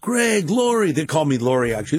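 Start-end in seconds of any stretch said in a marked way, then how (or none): 0.0-0.9 high, imitated buddy greeting; retold hailing. Friends greet Greg walking in, imitated hailing. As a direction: hail an old pal by name.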